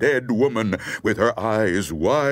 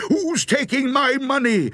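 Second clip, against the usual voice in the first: high, indignant holler; high-pitched storyteller's shout; alarmed shout; 12 semitones higher than usual. A narrator's reading. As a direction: call out high at a thief. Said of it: "the gravedigger shouted".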